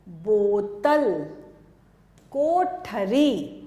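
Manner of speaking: बोतल and कोठरी drawn out slowly; slow classroom spelling-out; slow demo words. Shown as a demonstration. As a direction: sound out each word slowly for pupils. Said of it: "ये मात्रा है ओ".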